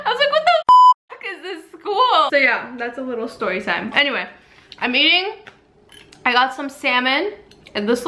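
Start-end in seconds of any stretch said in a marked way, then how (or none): 0.1-2.3 high squeal, laughing through it; laughing disbelief. Retold or imitated. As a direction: laugh out the outrage. Laughing disbelief while retelling.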